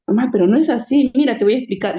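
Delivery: loud, earnest plea to mother; insistent correction. Retold or imitated. Daughter urging her mother to listen, loud earnest insistence. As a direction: raise your voice to convince her.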